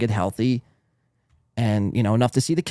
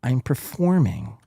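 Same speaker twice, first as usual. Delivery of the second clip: slow, drawn-out mocking echo of "I'm performing"; mocking quote; slower than usual. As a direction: sneer the words back at him.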